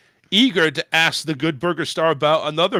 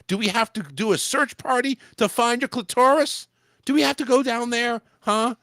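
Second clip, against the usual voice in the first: high, pestering mock interrogation; hammed-up, high-pitched nosy interviewer; mock prying; 8 semitones higher than usual. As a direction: fire off nosy questions in a raised pitch.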